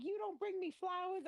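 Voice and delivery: high whiny mock woman's voice; mocking her. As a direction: squeal her complaints up high. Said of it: "She's like".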